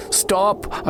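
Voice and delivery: high shout of alarm; scream. Acted. Narrator yells at the cousins, high shout. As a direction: yell to stop them. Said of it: "I screamed".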